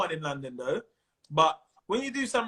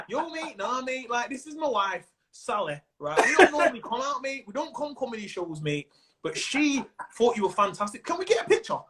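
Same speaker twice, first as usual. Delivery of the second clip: put-on Manchester accent; 2 semitones higher than usual. Manchester husband introducing wife to comedian, Mancunian accent. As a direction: act the chatty Mancunian punter, accent on. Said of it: "when you come to Manchester now".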